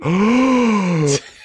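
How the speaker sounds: sharp delighted gasp; big astonished intake of breath; excited gasp. Retold or imitated. Evan gasps at the dwarves.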